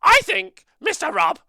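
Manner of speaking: very high squeaky puppet voice; Shilly voice. Acted. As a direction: shout it like a shrill little puppet. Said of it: "What does Shilly think of the app?".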